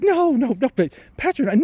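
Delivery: high-pitched supervisor protest; imitating flustered denial. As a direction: deny it in a lifted mock voice. Supervisor hastily denies patronizing him.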